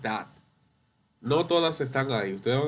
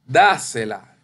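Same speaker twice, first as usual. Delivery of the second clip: Dásela with a loud first syllable DÁ; stress on DÁ; 2 semitones lower, 7 dB louder, slower than usual. Shown as a demonstration. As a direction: punch DÁ, let sela fall away. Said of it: "Ahí lleva la tilde".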